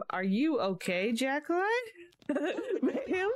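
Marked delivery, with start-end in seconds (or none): 2.3-3.4 high-pitched laughing squeal; helpless laugh breaking through the word. Real, not acted. Cracking up at the woman in the game, high laughing squeal.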